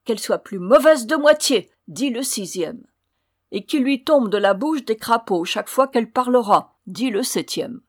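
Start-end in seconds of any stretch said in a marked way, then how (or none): 0.0-1.7 high, loud pronouncement; cursing dwarf. A narrator's reading. Sixth dwarf cursing the rude girl, loud and spiteful. 3.5-6.7 loud, emphatic spell-casting; cursing dwarf. A narrator's reading. Seventh dwarf laying the final curse, loud and vindictive.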